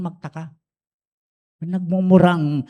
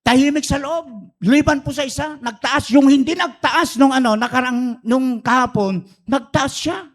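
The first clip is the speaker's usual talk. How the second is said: excited punchline build; 10 semitones higher, 6 dB louder than usual. A narrator's reading. Building suspense in the joke.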